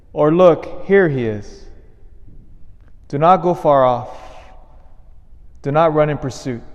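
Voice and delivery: measured, formal scripture reading; solemn Gospel reading. Acted. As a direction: read scripture to the congregation.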